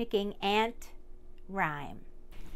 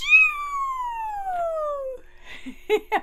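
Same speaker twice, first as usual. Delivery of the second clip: high laugh; 6 dB louder than usual. Real, not acted. After sneeze.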